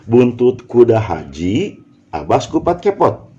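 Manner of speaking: "Buntut kuda haji" drawn out as one unit; pause after haji. Shown as a demonstration. Teacher trying another word grouping, pause after "haji". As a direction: break after "haji", then "abas, kupat, kepot" together. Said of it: "kalau begitu dengan haji".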